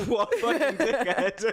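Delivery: high, loud laughing outburst; laughing hard. Real, not acted. Echoing partner's insult of the politician, cracking up. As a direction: crack up while getting the words out.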